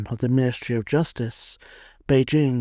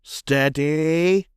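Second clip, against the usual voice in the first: drawn-out sergeant's call, rising on the repeat; sergeant's shout; much slower than usual. A narrator's reading. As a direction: hold the line with a stretched, rising command. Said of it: "The sergeant shouts".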